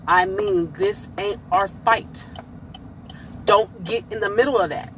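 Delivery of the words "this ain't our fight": slow, deliberate "this ain't our fight"; slow emphatic restatement. Real, not acted. Telling y'all to stay out, slow and emphatic on "this ain't our fight". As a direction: spell out "this ain't our fight" slowly, word by word.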